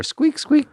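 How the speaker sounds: very high squeak squeak; squeaky cleaning noise. Retold or imitated. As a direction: squeak like a rag on a plate. Imitating plate-cleaning sound.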